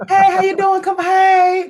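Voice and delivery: high, loud impression of a hailing voice; Mark Hammond. Guest shows his real voice, loud impression. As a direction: belt the greeting way up high. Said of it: "That's his real voice".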